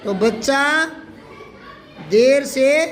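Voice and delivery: phrase by phrase, long pause between; spaced-out classroom dictation; slow dictation. Shown as a demonstration. Teacher dictates the example slowly.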